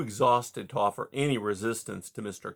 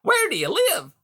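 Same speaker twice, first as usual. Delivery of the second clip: much higher, louder hearty voice; hearty beaver; 7 dB louder than usual. A narrator's reading. Beaver Mister Conrad greeting caged Rye, high and hearty. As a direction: boom out a jovial salesman beaver.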